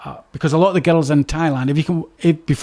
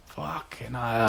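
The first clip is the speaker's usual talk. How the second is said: 1 muttered disbelief; 5 semitones lower, 11 dB quieter, much slower than usual. Retold or imitated. Reacting to murder story.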